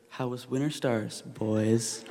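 dry, knowing mom with arms crossed; super sarcastic. Retold or imitated. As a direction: imitate a mom who knows she's been lied to. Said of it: "in this super sarcastic tone".

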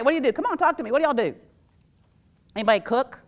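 ordinary talk, nothing marked

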